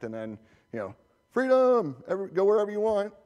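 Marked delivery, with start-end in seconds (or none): 1.3-3.1 high, loud mock cheer of freedom; much higher, exaggerated whoop; mock free-for-all. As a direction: cheer it like the users are let loose. Mocking unrestricted network access.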